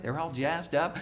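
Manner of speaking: normal talking voice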